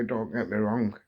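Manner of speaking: normal talking voice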